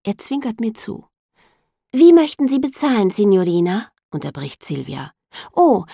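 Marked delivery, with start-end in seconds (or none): 1.9-3.9 high, bright polite sales voice; saleswoman voice. A narrator's reading. 5.5-5.9 surprised. A narrator's reading. Startled exclamation.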